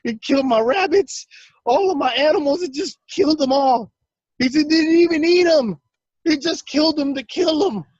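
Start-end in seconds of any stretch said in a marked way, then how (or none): 0.0-3.9 high, distraught retelling of uncle's rant; yelling outrage. Retold or imitated. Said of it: "he's yelling and screaming". 4.7-7.8 high, distraught retelling of uncle's rant; yelling outrage. Retold or imitated. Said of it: "he's yelling and screaming".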